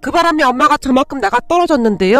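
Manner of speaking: plain reading voice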